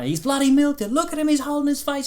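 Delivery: mock Aussie pundit fuming in an Australian accent; outraged Australian. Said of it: "delivered in an Australian outraged accent".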